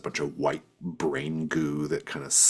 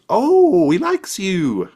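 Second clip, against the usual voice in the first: very high, loud goblin squeal, then slow; young goblin gushing about her pet lobster; squeaky goblin girl; 11 dB louder, much slower than usual. Acted. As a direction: coo it like a delighted little goblin.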